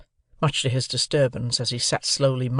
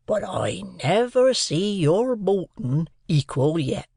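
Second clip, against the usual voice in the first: slow, higher earnest Joe; 3 semitones higher, slower than usual. A narrator's reading. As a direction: marvel at the boy as Joe. Joe talking to boy.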